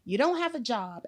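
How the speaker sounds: ordinary talk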